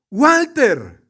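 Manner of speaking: calls out the name loud and high; calling a name. Acted. Family in heaven greeting Walter, calling out his name loudly. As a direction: hail him by name across heaven.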